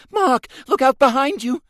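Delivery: high, loud scream of warning. A narrator's reading. Rose warning Mark of a boarder, screaming. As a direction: shriek the warning. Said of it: "Rose whirled round and screamed".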